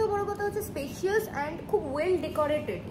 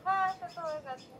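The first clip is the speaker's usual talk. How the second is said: soft, higher, distant phone reply; voice over phone; 4 semitones higher, 6 dB quieter than usual. Real, not acted. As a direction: answer faintly from the other end. Friend answering on a phone call.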